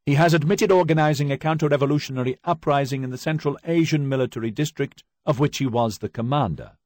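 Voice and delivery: loud, high, formal reading of the charges; stern prosecutor. Acted. Prosecutor reading charges to the accused, loud and formal. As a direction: announce the indictment to the whole court.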